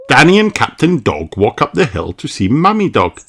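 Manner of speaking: plain reading voice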